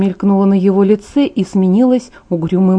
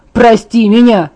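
louder, scornful shout; furious retort; 2 semitones higher, 6 dB louder, slower than usual. Acted. Angry Jacob throws Bella's apology back at her, shouting.

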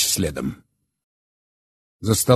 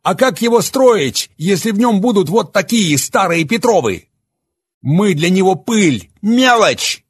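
raised, much higher and louder angry voice; Oleg, bitter and enraged; 12 semitones higher, 6 dB louder than usual. Acted. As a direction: hurl the confession at them defiantly. Oleg's bitter outburst.

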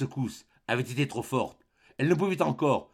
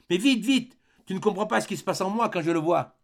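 much higher, urgent; panicked urging; 8 semitones higher than usual. A narrator's reading. Mother urging her son to hurry, panicked. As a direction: hurry him, fear breaking through.

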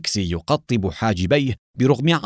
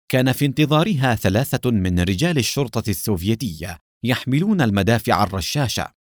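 ordinary delivery, nothing marked

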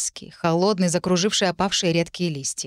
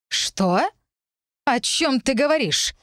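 ordinary delivery

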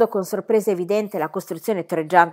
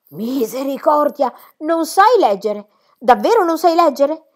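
horrified cry; 8 semitones higher, 8 dB louder than usual. Acted. Governess cries out in horror that Heidi cannot read. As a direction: recoil in dismay. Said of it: "gridò con orrore".

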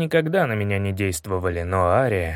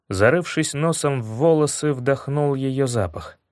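usual speaking voice

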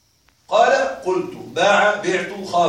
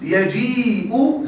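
drawn-out prompt; much slower than usual. Shown as a demonstration. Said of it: "ها،".

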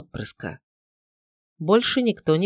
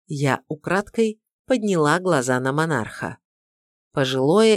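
plain reading voice

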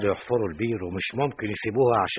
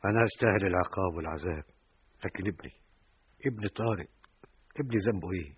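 broken grief; 4 semitones lower, 6 dB quieter than usual. Acted. Grieving father.

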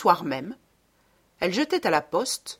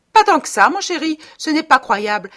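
put-on high, loud, wheedling young wife; high coaxing wife; 8 semitones higher, 10 dB louder than usual.

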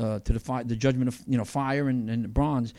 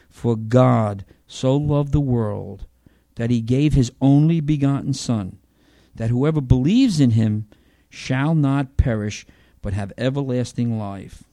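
slow, loud solemn reading; scripture reading; 5 dB louder, slower than usual. Acted. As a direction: read the verse with reverence. Reads John 3:16 aloud.

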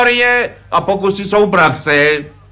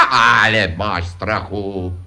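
low, drawn-out brush-off; dismissive reassurance; 9 semitones lower, much slower than usual. Acted. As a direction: wave the worry away, slow and low. Táta odbývá synovo varování. (odbyté hlubokým, táhlým hlasem)

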